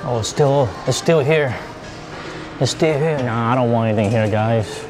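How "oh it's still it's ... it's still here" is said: usual speaking voice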